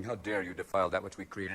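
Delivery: low, soft, grave outrage; stern George Washington. Acted. George Washington scolding bin Laden, low and grave. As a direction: intone it deep and solemn.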